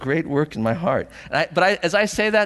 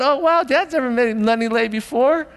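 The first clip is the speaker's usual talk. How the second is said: put-on amazed kids; 10 semitones higher, 4 dB louder than usual. Voices his kids watching Dad.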